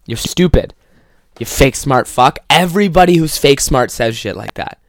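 ordinary delivery

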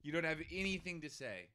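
high, soft, teasing disbelief; mock needling. Reporter teases the silent guest, high and soft. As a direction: needle him in a sing-song.

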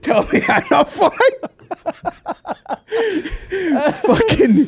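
high, loud laughing yell; laughing shout. Real, not acted. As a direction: crack up mid-rant, loudly. Laughing through the rant.

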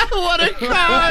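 laughing mockery. Mocking the joke together.

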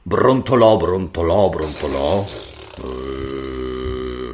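sinking into a low grumble; grumbling. Shown as a demonstration. Narrator showing a child how the daughter grumbles, grumbling. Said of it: "come fa a brontolare?".

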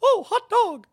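very high, loud cartoon falsetto; hammed-up gleeful mouse squeal; Mickey Mouse. Fan stuck in cartoon mouse voice, squealing. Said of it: "Mickey Mouse all of a sudden".

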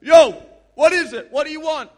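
put-on loud, high cheeky boy; the boy wakes the boss, loud and casual; cheeky young kid. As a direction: blurt it out like a startled teen. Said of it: "he goes up to him and goes".

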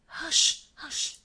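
sudden frightened hush, half breathed; unvoiced gasp of a hush, then a mild fading one; dismayed stammer. A narrator's reading. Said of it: "stammered the girl in a sudden dismay".

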